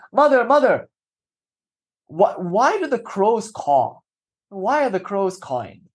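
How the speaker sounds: put-on high, slow child's question; a little boy asks his mother, high and wondering; small boy. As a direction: ask like a curious little boy. Boy's question in a retold story.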